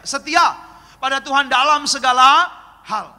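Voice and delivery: loud, rousing pulpit delivery; rally the congregation with forceful exhortation; preaching at full force. Acted. Preacher urging congregation to trust God, loud exhortation.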